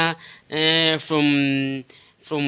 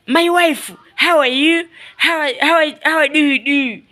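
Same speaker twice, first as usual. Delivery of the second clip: mock German English; 8 semitones higher than usual. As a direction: sing out greetings like a hammed-up German. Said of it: "Eh, like this. Eh, like German".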